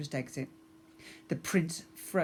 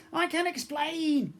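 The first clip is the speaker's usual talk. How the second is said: much higher, slow frightened yell; 13 semitones higher, 3 dB louder than usual. A narrator's reading. Goblin prince pleading with the pirate girl, high frightened yell. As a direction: plead while dragged. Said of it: "Prince Grogba yelled".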